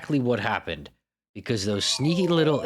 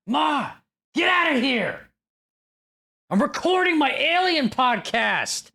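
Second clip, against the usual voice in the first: high-pitched bratty yell to mom; whine-shout like an annoyed son interrupted mid-recording; play-acted tantrum, pitched way up; mock yell at Ma; 14 semitones higher, slower than usual. Playing bratty son yelling at mom.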